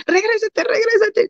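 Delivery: very high call to turn back; husband calling back. Retold or imitated. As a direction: call her back through the rain. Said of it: "mi esposo". (esposo gritando que regrese)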